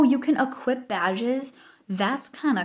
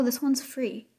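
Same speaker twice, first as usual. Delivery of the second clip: ordinary delivery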